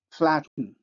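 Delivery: normal talking voice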